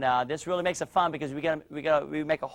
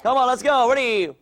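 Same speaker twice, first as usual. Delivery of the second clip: high, loud cheering; shouted encouragement; 7 semitones higher, 6 dB louder than usual. Real, not acted. Coach urging Katie to kick and yell, shouting.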